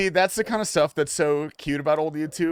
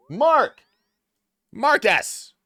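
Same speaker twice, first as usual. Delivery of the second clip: high, loud call of the name; sharp scolding shout; 11 semitones higher, 7 dB louder, much slower than usual. Real, not acted. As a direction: yell to stop him misbehaving. Breaks off to scold Mark.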